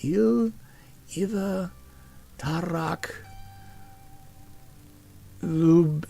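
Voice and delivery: high, careful rune reading; sister's careful attempt. A narrator's reading. Sister repeating orc phrase for her brother. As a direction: sound out each rune word carefully. Selena repeats runes for tutor.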